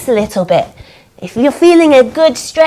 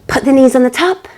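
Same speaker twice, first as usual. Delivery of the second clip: ordinary talk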